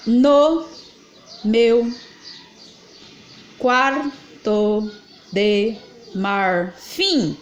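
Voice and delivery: very slow, each word set apart by pauses; slow syllable scansion. Shown as a demonstration. Teacher scanning the verse for students, very slow. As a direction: read the line word by word for counting. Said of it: "Então, aqui, ó".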